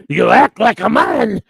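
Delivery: slow, loud, hammed-up mafia boss scolding; hammed-up mob boss. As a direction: bark it out slowly like a mock Don. Godfather-style chat quote.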